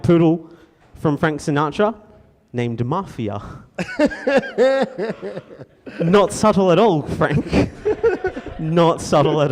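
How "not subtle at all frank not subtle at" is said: laughing through the words, high and loud; chuckling, pitched up and louder. Real, not acted. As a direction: giggle it out. Laughing host aside.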